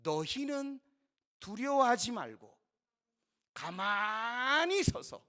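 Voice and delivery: loud, slowing prophetic proclamation; crying out. Retold or imitated. Moses to the grumbling Israelites, crying out. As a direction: call out firmly over a crowd, stretching words. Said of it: "이렇게 외칩니다".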